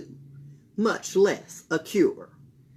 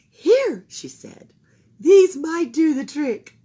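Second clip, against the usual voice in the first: put-on old woman voice; 9 semitones higher, 11 dB louder, slower than usual. A narrator's reading.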